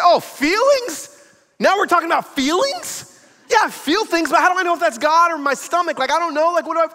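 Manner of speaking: high, exasperated mock rant; flustered friend protesting, pitch well up; stressed out. Stressed friend asked about feelings, high exasperated protest. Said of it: "he was so stressed out".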